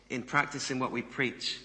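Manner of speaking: normal talking voice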